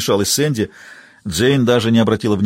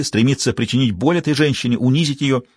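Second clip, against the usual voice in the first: ordinary delivery, nothing marked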